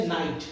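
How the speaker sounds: "नाइट" starting straight on N, no K; silent K. Shown as a demonstration. Teacher illustrating K before N, "नाइट" with silent K. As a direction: say "नाइट" with the K left out. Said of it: "के किसके पहले होना चाहिए?".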